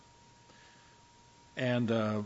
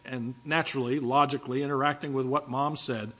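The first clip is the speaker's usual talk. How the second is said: ordinary talk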